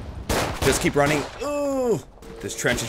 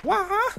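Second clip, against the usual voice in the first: startled yelp. Real, not acted. Startled in game.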